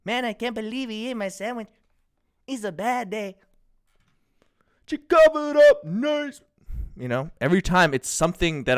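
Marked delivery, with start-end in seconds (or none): 0.0-3.4 imitating a singer. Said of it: "I'm imitating someone". 4.9-6.4 imitating a singer. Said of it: "I'm imitating someone".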